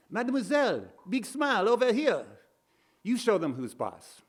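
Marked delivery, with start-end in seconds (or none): 0.1-2.2 put-on high-pitched French-accented yell; French accent, pitched way up, hailing a star; French paparazzo. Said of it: "French accent of a paparazzo yelling".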